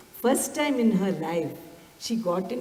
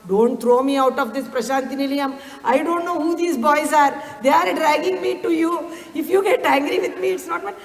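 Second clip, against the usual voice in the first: higher-pitched, retold anxious plea; petrified pleading; 6 semitones higher, 3 dB louder than usual. Frightened lady praying on the journey, petrified pleading. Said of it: "really petrified that she was doing something against".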